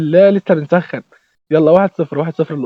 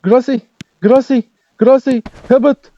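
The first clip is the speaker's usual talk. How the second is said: rising higher and louder toward "جول بقى"; pleading excitement; 7 semitones higher, 3 dB louder than usual. Real, not acted. Streamer begging his striker to score, building high and loud. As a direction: beg him to score, building.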